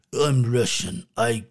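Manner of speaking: put-on a terrible Russian accent, half joking; deadpan fake Russian accent; bad Russian accent. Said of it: "was a terrible Russian impression".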